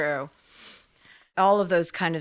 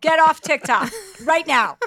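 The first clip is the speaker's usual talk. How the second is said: loud, high, slow mock command; scolding sister; 9 semitones higher, 7 dB louder than usual. Sister scolding her older sister off TikTok, loud mock order. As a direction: order her off the phone like a parent. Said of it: "I'm like".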